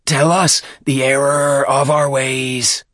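reverent, drawn-out plea; wailing devotee. A narrator's reading. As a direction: draw out each word in supplication. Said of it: "the philologist wailed".

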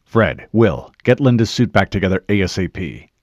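loud, commanding military orders; rapid-fire orders. A narrator's reading. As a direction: give each team its job at firm volume. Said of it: "The Master Chief gave rapid-fire orders".

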